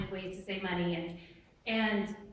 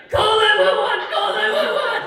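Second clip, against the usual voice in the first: high, loud mock emergency shout; retold panicked yell; 10 semitones higher, 13 dB louder than usual. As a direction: shout it like he's dying. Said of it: "he's like".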